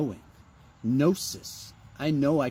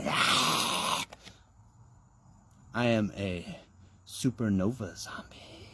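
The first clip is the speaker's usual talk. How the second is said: breathless whisper, much softer; 7 semitones lower, 5 dB quieter, slower than usual. Pretend. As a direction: hush it like a secret. Zombie bit, voice drops out.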